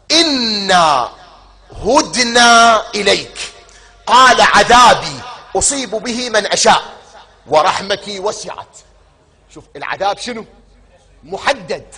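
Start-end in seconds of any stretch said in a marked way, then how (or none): 0.1-8.8 high, loud, slow declaimed verse; preaching at full force. Acted. Preacher declaims the verse of mercy to the congregation, loud and slow. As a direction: proclaim the verse with full power.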